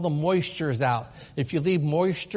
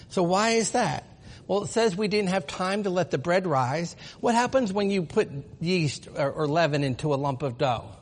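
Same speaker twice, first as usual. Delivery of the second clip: normal talking voice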